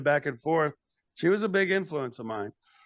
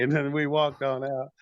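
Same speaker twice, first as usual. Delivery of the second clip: usual speaking voice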